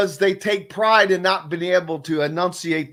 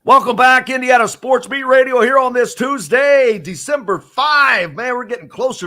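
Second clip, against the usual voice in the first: loud, higher, upbeat radio welcome back; radio host intro; 7 semitones higher, 5 dB louder than usual. Acted. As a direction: open the segment like a pumped-up host.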